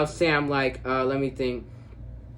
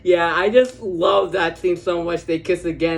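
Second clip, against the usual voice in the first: excited gush; 4 semitones higher, 7 dB louder than usual. Real, not acted. Gushing after laughing at scene.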